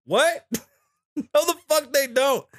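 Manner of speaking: high-pitched, incredulous protest; indignant disbelief. Retold or imitated. Patient disputing everybody has heart pain, indignant disbelief. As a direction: push back in a high, baffled voice.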